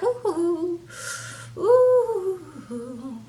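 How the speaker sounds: high drawn-out "ooh" fading away; imitated smoking puffs. Angela smoking after the altercation, imitated puffing oohs. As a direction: blow out long, high oohs like exhaling smoke. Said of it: "smoking a cigarette, like".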